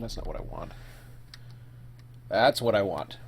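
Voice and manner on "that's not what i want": muttering. Real, not acted. Muttering to self at screen.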